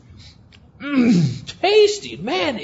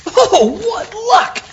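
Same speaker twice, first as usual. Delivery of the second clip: mock delight; 8 semitones higher, much slower than usual. Finds the sound effect CD.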